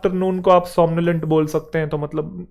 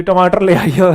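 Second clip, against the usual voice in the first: louder put-on voice of a sleep-talking mother; imitated sleep-talk; 3 semitones higher, 8 dB louder than usual. Son retelling his sleeping mother's tomato question, imitated sleep-talk. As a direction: mimic mum talking in her sleep, loudly. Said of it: "नींद में बोल रही थी".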